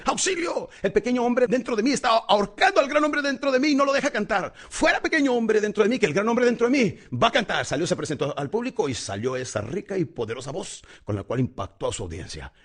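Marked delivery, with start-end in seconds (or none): 0.0-7.6 high, loud cry, pitch held up the whole way; desperate cry for help. Acted. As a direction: call out for help like a singer afraid of the stage. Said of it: "la abre y dice". (grito desesperado pidiendo auxilio, agudo y fuerte)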